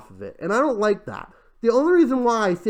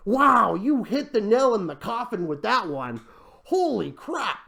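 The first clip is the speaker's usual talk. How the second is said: sarcastic amazement; 3 semitones higher than usual. Pretend. Vlogger mocking a pitch's obvious risk, sarcastic amazement. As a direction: act hugely impressed, sarcastically.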